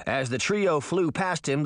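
ordinary delivery, nothing marked

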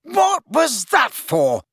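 high, drawn-out angry shout; bellowing. A narrator's reading. Friend just punched demanding why, bellowing. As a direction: bellow each word indignantly. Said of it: "Harry bellowed".

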